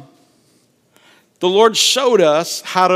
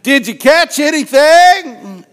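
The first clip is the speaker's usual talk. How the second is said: high, loud, stretched-out holler; teasing long-distance shout; yelling out; 9 semitones higher, 7 dB louder, slower than usual. Acted. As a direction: call across the water to a distant boat. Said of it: "he yells out".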